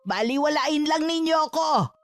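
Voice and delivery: high-pitched, ringing warning; angry threat. Acted. Mother warns the pair they will regret it, angry and threatening.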